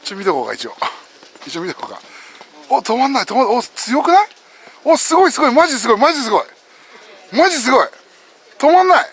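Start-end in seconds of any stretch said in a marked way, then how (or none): 2.7-9.1 high, loud cheering reaction; real astonished exclamations; amazed excitement. Real, not acted. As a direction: marvel out loud, getting higher as it builds. Amazed at climber not stopping.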